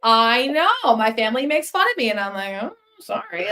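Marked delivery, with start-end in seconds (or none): 2.6-3.5 tiny high, soft "sorry"; mock apology. Teased by family for being cold, high soft mock apology. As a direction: squeak out a quiet mock apology.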